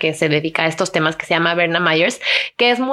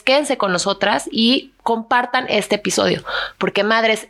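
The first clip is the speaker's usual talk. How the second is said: ordinary talk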